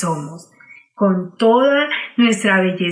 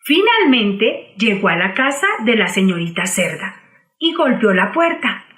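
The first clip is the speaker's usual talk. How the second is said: plain reading voice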